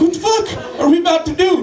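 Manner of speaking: high, laughing what-the; retold stunned reaction at the chemistry set; alarmed disbelief. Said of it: "I'm like".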